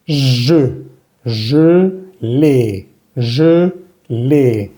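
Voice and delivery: "Je" with rounded closed schwa, syllables stretched apart; slow, careful "je ... l'ai", first vowel rounded; French schwa in JE. Shown as a demonstration. Teacher models gelée for Spanish speakers, rounded schwa in JE. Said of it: "la primera E que vimos, es E".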